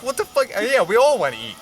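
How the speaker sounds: high, disbelieving outburst; mock outrage at a hungry buddy; incredulous. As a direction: react like it's the dumbest idea ever. Incredulous reply to buddy at night.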